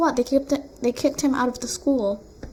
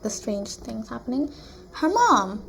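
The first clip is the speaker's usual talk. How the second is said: ordinary talk, nothing marked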